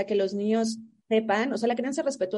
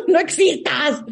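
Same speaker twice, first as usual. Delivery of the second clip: laughing; 6 semitones higher, 6 dB louder, much slower than usual. Real, not acted. Friend laughing, echoing the line.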